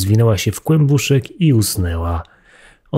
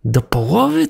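high rising disbelief; astonished question; 6 semitones higher than usual. A narrator's reading. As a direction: throw it back in shock. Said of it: "zawołała mysz".

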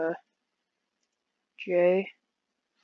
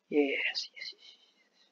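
whispered, trailing repetitions; hushed and slowing to nothing; fading whisper; 3 semitones lower, 7 dB quieter, much slower than usual. Real, not acted. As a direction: let it fade away in a whisper. Muttering yes to self, fading.

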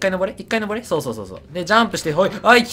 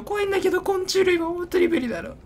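high-pitched whine; 13 semitones higher than usual. Real, not acted. Complaining about insects.

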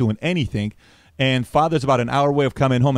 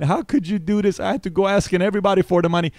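put-on crying kid; 7 semitones higher than usual. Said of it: "The kid starts crying".

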